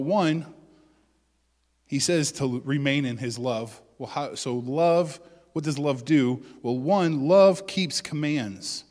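ordinary talk

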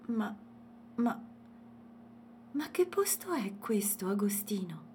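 halting starts, then high amazement; stammering wonder. A narrator's reading. Girl asks the elephant where they are, bewildered. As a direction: falter between words, dumbfounded.

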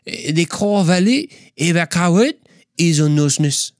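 hammed-up French accent attempt. Speaker tries French next for his listeners, French accent. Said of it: "What about French?".

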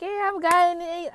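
imitating proud girls, high and loud; slow, high boast in a retold voice; proud declaration. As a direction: announce the heritage loudly, showing it off. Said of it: "they were proud of their African culture".

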